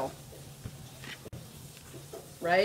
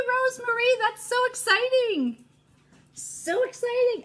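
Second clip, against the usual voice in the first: excited squeal; 13 semitones higher than usual. Real, not acted. Greeting viewer excitedly.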